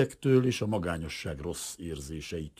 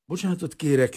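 higher, louder apology through chattering teeth; stammering, teeth chattering; 11 semitones higher, 8 dB louder than usual. A narrator's reading. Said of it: "hebegte vacogó szájjal". (hebegve, vacogó szájjal)

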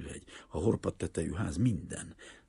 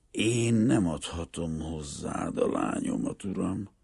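high, raised voice, carefully measured; deliberate, earnest; 8 semitones higher, 8 dB louder than usual. A narrator's reading. Greek father refusing to give the banker his daughter, earnest and deliberate. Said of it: "lassan, komolyan, nagyon megfontoltan".

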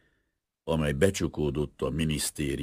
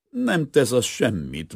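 loud, slow, high-pitched good cheer; cheerful; 9 semitones higher, 7 dB louder, slower than usual. A narrator's reading. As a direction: wave it away merrily, drawing it out. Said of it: "mondta vidáman". (vidáman)

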